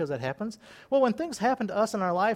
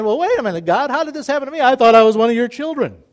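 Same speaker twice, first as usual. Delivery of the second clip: loud, higher protesting voice; indignant complaint to God; 4 semitones higher, 10 dB louder, faster than usual. Retold or imitated. Believer protesting to God, loud and indignant. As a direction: whine it as if wronged. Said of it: "You're saying".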